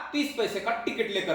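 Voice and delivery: ordinary talk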